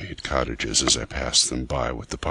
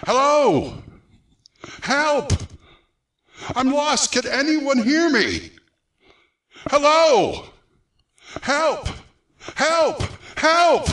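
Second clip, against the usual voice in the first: high desperate yells for help; loud shouting; 4 dB louder, slower than usual. Acted. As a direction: shout for rescue at the top of your lungs. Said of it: "I set up a series of loud shoutings".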